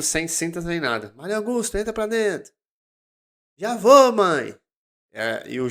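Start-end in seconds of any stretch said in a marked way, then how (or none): 1.2-2.5 high-pitched mock call of a mother; retold motherly summons in a raised pitch; mother calling child in. Mother calls child in from street. 3.6-4.6 put-on high, loud kid's holler to mum; mock childhood yell, pitched up; child calling back. Child answers mother's call.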